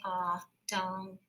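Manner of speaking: ah and dong said apart as two syllables; sounded out. Shown as a demonstration. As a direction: split it as ah, dong. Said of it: "So I'm gonna say".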